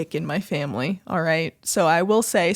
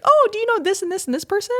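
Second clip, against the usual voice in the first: mock nosy stranger; 11 semitones higher, faster than usual. Mimicking strangers asking about names.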